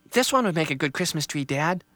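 put-on an eager child pointing out a tree; lightly voiced child. Child tells Dad about the tree, lightly voiced kid.

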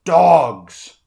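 very loud, high roar of command; explosive roar; lion-like roar. A narrator's reading. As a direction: silence the whole crowd with one word. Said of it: "silenced with a lion-like roar".

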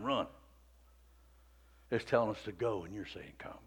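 very soft, low, hushed praying voice; quiet prayer. Acted. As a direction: pray gently over the congregation. Soft altar call and prayer.

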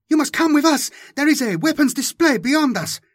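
high, loud urgent call; eager shout. Acted. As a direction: urge him along at full voice. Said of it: "she shouted".

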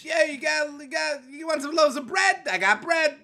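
high, loud Jersey preacher; New Jersey pastor. Acted. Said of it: "pastor from New Jersey".

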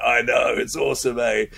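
loud, delighted comeback; dad gleefully owning his cheesiness to his son; gleeful retort. Retold or imitated. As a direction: shout back with proud glee. Dad teasing back at son.

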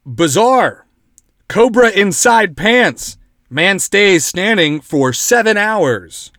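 slow, loud announcer delivery; hammed-up news announcer; dramatic headline read. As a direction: proclaim each word like a shocking headline. Reads headline in announcer style.

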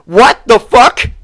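loud, high, slow disbelief; incredulous outburst. Acted. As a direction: draw out each word in disbelief. Incredulous at partner's calm answer.

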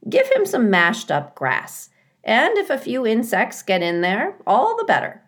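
high, loud chattering goose; a goose ticking off easy chores; breezy mother goose. A narrator's reading.

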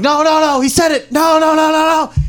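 high, loud shouts of protest; panicked managers. Acted. Managers about to be deleted protesting, in a loud panic. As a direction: cry out frantically, far above your normal pitch.

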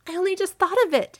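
high, loud frantic wife; hysterical. A narrator's reading. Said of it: "she said hysterically".

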